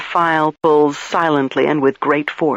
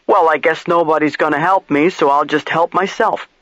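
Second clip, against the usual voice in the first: ordinary delivery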